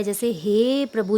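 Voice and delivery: हे प्रभु stretched slowly, हे held long; slow, lingering invocation; drawn-out call. Shown as a demonstration. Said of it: "जिसमें हम ज़्यादा समय लगाकर बोलते हैं".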